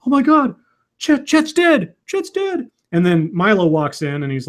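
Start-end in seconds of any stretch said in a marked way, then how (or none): 0.1-2.7 high-pitched imitation of a panicked henchman; mock panic. Henchman Pablo finds Chet dead, mock high-pitched panic. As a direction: cry it out in hammed-up shock.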